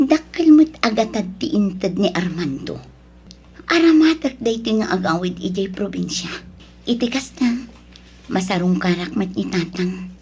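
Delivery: soft thoughts spoken low to herself; quiet inner monologue. Acted. Daughter thinking of going home, quiet inner voice. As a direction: murmur private thoughts, hushed.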